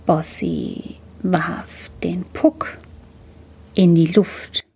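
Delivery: slow, hushed slow-motion telling. A narrator's reading. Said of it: "muss man in Zeitlupe erzählen".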